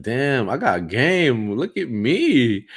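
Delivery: drawn-out, swooping self-congratulation; hammed-up swagger, stretched words; cocky boasting. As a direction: crow it slowly, loud and high. Acts out cocky inner boast.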